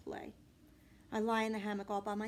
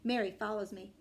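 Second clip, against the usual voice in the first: ordinary delivery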